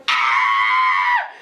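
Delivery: shouted scream in a changed voice; imitated dog scream. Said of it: "at the highest pitch of her voice".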